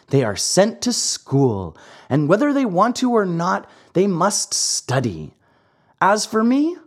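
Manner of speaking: a lightly voiced naughty puppet ordering him out; cheeky marionette. Pinocchio's held voice.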